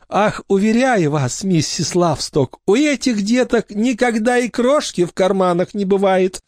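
high, sugary eager-to-please voice; butter up the important visitor; the orphanage director assuring the guest, fawning; fawning matron. A narrator's reading.

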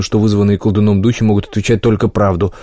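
ordinary delivery, nothing marked

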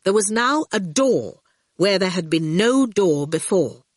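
ordinary delivery

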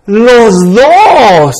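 put-on loud, drawn-out child's command; bossy little king. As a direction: order both parents like a tiny king. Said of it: "Ahora es adrede. Ahora viene la mamá".

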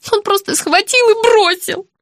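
usual speaking voice

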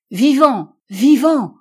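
high, loud cry of amazed joy; exclaiming. A narrator's reading. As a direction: shout it out in disbelief and relief. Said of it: "s'écria-t-il".

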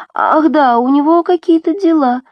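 high, singsong airy excuse; unconvincing fib. A narrator's reading. Nadya who can't lie, airy excuse. Nadya lying badly to Abdul.

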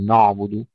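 نعبد said thick and backed; wrongly heavy letters. Shown as a demonstration. Teacher showing what to avoid, heavy نعبد. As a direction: thicken the opening of نعبد into emphatic sounds. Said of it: "وأيضًا لا نفخمها".

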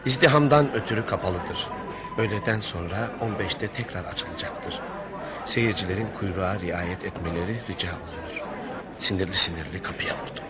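story narration. A narrator's reading. Inner narration over music.

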